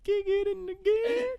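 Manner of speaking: high, loud squeaky echo. Acted. Echoed in squeaky voice.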